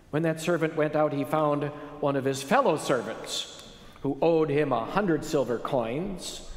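ordinary delivery

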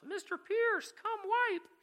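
very high, loud mock yell; imitated child's yell. Little boy calling his carer from the bathroom, imitated yell. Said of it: "starts yelling at the top of his lungs".

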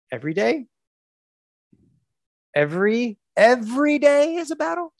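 rising, stretched incredulous question; mock disbelief. Coach challenging his client's every-day-battle claim, mock disbelief.

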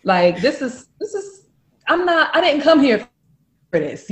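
loud acted exasperated rant; fed-up telling-off. Woman ordering boyfriend home from work, loud exasperation. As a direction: protest loudly, voice jumping up.

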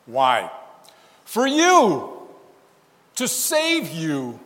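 For you cried out high and loud; loud, fervent preacher's climax; climactic cry. Real, not acted. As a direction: burst out with the answer. Climactic appeal to listeners.

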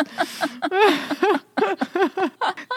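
giggling fit; long high laugh. Real, not acted. Laughing at own joke.

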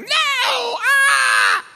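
very high, loud mock scream; hammed-up tantrum shriek; toddler yelling. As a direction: imitate a child yelling a refusal. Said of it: "keeps yelling".

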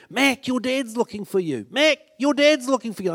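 put-on kids calling out. Schoolkids calling to him.

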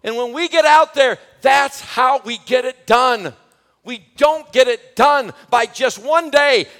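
loud, rising sermon shout; fiery pulpit preaching, voice pushed hard; full-force preaching. Acted. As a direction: preach it to the back row with full passion.

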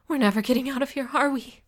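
high, loud, rushed alarm; breathless, frightened question; spiraling panic. A narrator's reading. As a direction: rush it out as panic takes over. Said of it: "my panic spiraling higher and higher".

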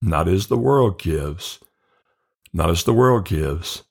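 slow, each phrase stretched over a breath; slow breath-paced repetition. Shown as a demonstration. Teacher breathes through the verse for listeners, slow and calm. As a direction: draw the words out like a calm breath. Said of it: "Third part".

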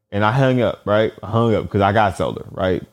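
ordinary delivery

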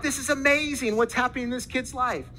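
high-pitched retold amazement; acted wonder, pitched well up; amazed excitement. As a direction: relive rushing over with astonishing news. Excited report back at the car.

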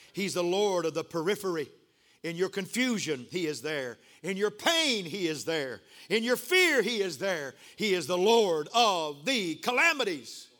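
building preaching. Acted. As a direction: build phrase by phrase, punching PAIN and FEAR. Preaching, building to full force.

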